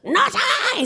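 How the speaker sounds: high, squawked duck voice; aggressive duck. Acted. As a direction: squawk it out as a pushy duck, stretching the word. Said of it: "that's what it sounds like now".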